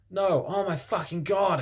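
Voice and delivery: slow, hammed-up frightened cry; mock terror, dragging the words out; a reader waking from a nightmare, half-acting the fear; scared shout. A narrator's reading. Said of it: "I shouted in a scared voice".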